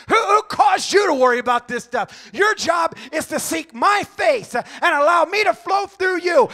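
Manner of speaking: booming, high rebuking shout; voice of God. Retold or imitated. God rebuking the preacher, booming shout. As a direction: thunder the questions down at him.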